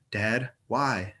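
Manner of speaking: put-on toddler voice. A narrator's reading. Toddler girl asks.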